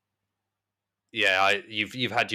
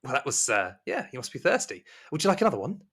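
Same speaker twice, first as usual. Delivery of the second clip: high, polite serving voice, climbing to the question; sweetly attentive servant, rising at the end; Rosemary; 5 semitones higher, faster than usual. Acted. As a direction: smile and offer more, pitched high. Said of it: "unblinkingly at you and smiles and goes".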